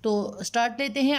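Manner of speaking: ordinary talk, nothing marked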